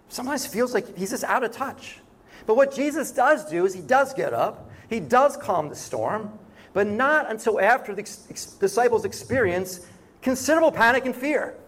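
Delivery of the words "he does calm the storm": usual speaking voice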